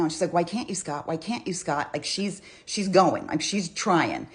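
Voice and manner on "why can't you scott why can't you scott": ordinary talk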